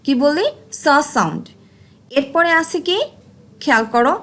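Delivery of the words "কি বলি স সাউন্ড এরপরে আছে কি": ordinary talk, nothing marked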